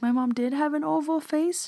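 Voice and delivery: high, stunned question; asking in retold shock, voice up high; shocked disbelief. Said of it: "she was in shock".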